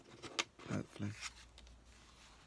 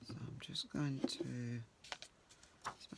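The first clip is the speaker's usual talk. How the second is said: whispered aside; 5 dB quieter than usual. Real, not acted. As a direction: breathe it out under your breath as you work. Whispered while working.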